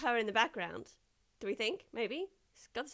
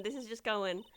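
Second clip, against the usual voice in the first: normal talking voice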